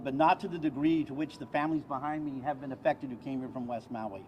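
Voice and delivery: ordinary talk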